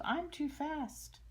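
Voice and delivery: put-on high, loud taunting child; playful yell. Amelia running from the teacher, high playful yell. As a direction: call it out teasing, like a game. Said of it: "she yelled".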